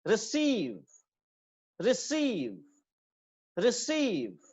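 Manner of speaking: Receive with short "ri" and long "SEEV"; second-syllable stress. Shown as a demonstration. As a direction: hold the stressed "सीव" in receive. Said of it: "second syllable पे होगी".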